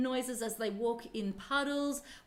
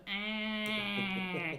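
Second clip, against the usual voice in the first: donkey bray. Shown as a demonstration. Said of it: "they sound a lot like donkeys".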